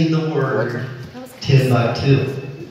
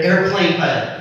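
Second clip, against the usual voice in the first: ordinary talk, nothing marked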